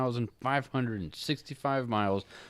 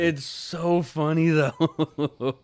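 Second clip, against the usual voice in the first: laughing; 7 semitones higher, 5 dB louder, slower than usual. Real, not acted. Laughing defending joke.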